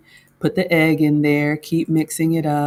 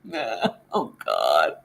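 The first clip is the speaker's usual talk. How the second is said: a much lower, hollow "Oh, God"; mock dread; 10 semitones lower, 4 dB quieter, much slower than usual. Host retells panicking over batter, low mock groan. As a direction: drop it down into mock doom. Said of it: "I'm just like".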